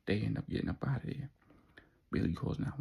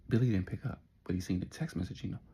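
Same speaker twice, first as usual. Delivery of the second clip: ordinary delivery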